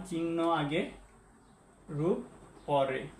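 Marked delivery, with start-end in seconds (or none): none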